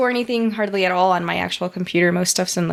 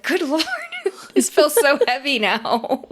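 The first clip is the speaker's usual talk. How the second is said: higher, laughing through a mock groan; laughing mock complaint; 7 semitones higher than usual. Comparing old computer's weight, laughing mock dismay. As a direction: giggle out a playful gripe about the weight. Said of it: "you're like".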